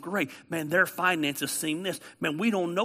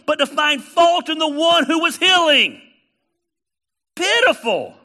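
loud, high fervent preaching; preaching at full force; 8 semitones higher, 7 dB louder than usual. Acted. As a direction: hammer it home from the pulpit.

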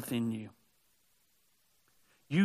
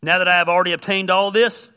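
forceful scripture reading; 6 semitones higher, 11 dB louder than usual. Shown as a demonstration. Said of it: "Philippians three".

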